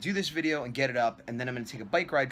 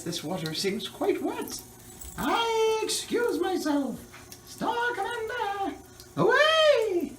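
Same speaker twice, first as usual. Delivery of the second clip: high, slow hammy hero voice; heroic cartoon voice; 4 dB louder, much slower than usual. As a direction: play a stage space commander. Comic movie-hero bit on cue.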